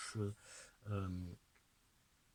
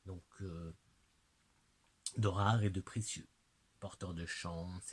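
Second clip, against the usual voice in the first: usual speaking voice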